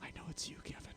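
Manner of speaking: very soft, voiceless secret whisper. Retold or imitated. Host retells speaking to the disguised guard, whispering. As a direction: whisper it barely audibly, as if into an ear. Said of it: "that's when I whispered to him".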